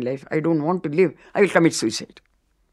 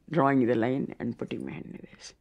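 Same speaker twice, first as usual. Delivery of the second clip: awed whisper; 5 semitones lower, 6 dB quieter than usual. Real, not acted. Awed memory of Baba's touch.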